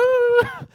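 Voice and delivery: sudden high, loud imitated whoop. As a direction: let out a wild, high whoop. Retelling wife's sudden outburst.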